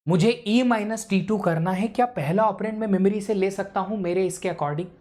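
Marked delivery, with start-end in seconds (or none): none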